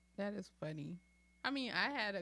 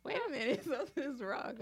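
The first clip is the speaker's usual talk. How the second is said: high, louder, laughing through the words; mock alarm breaking into laughter; mom's shock retold with laughs; laughing retelling; 9 semitones higher, 5 dB louder than usual. Mom retelling birth, laughing.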